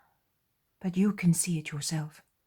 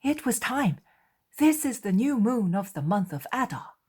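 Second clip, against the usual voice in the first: higher and louder, glad; eager apostle; 7 semitones higher, 8 dB louder than usual. Acted. Andrew telling the others it is the new moon, higher and excited.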